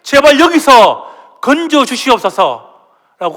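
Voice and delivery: a people crying out under forced labour, begging for rescue; anguished cry for deliverance, pleading; desperate wailing plea. Acted. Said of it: "통곡하면서".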